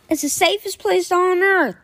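mock awe. Mocking the safety-obsessed driver.

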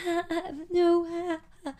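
stall, embarrassed and cornered; imitating her uncomfortable stammer; awkward stammer. Awkward question about boyfriend.

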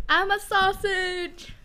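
high, drawn-out mock voice; mimicked texted reply. Acting out a friend's texted reply, high and drawn out. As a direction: stretch it out slowly, pitched high. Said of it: "He go".

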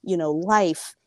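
ordinary delivery

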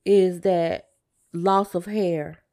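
ordinary talk, nothing marked

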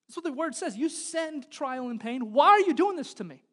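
re-enacted angry prayer, building from exasperated to a high full shout; yelling at God. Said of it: "I'm just yelling at God".

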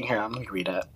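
breath-only aside before reading; whispered aside. Real, not acted. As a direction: murmur it half to yourself. Aside while looking up text.

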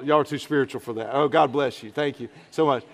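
ordinary talk, nothing marked

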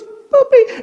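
put-on very high tiny-child voice; comic high toddler imitation; granddaughter's voice. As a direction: imitate a little girl in a squeaky high pitch. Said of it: "she goes".

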